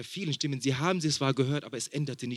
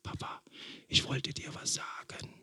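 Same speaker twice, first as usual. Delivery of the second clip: imitating a child's whisper; 11 dB quieter than usual. Father retells his son whispering into his ear, hushed whisper. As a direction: breathe it like a small boy whispering in dad's ear. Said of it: "flüstert er in meinem Ohr rein und sagt".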